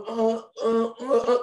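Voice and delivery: high, halting hesitation noises; stumped stammer. Acted. Teacher stumped by father's firefly question, flustered stammer. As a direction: stall with repeated high-pitched stammers.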